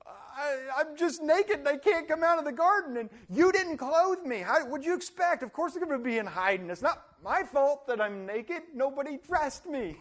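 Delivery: hammed-up blubbering blame; Adam whines his excuses to God, mock crying; whiny crying excuse.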